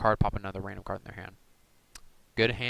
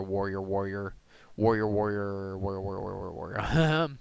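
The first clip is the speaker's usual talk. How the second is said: playful droning repetition; 2 semitones lower, slower than usual. Pretend. Deck reviewer stuck on a card type, silly droning repetition. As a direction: run the word together in a silly drone. Playfully loops the same word.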